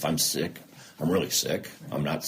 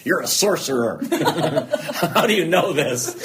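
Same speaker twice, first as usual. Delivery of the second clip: higher and louder, laughing through the words; laughing disbelief; 11 semitones higher, 7 dB louder, slower than usual. Real, not acted. Telling his therapist he must be a sorcerer, laughing.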